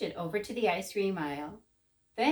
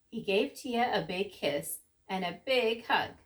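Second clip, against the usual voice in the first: usual speaking voice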